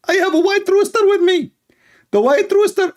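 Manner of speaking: high mocking preacher voice; squeaky sermon mimicking a preacher; Ali Dawa. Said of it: "Ali Dawa".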